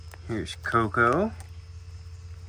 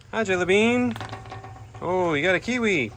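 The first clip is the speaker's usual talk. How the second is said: high pet talk; 8 semitones higher than usual. Real, not acted. Greeting her bird Jelly Bean, high sing-song pet talk. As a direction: coo at the little bird.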